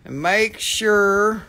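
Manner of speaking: very slow, word-by-word sale announcement; slow, stretched-out proclamation; deliberate announcement. Pretend. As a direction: draw out each word with pauses between.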